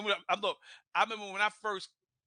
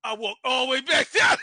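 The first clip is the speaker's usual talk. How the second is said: high, loud crying laugh; laughing wail; 6 semitones higher, 11 dB louder than usual. Real, not acted. Admits fleeing the deer.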